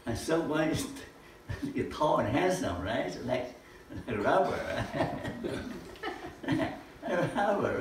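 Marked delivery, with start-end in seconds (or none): 4.1-7.8 laughing through the words. Real, not acted. Speaker joking to the audience about looks, laughing. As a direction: chuckle it out, amused at yourself.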